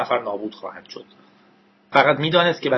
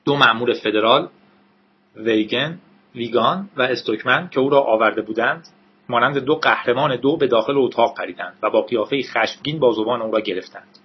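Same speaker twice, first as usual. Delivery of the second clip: ordinary delivery, nothing marked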